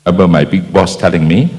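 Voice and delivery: normal talking voice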